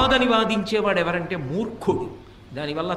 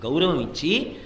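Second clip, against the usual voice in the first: ordinary talk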